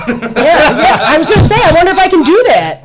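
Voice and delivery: high, loud and eager; thrilled by a new idea; excited. Real, not acted. Host excited about offering a discount code, eager and loud.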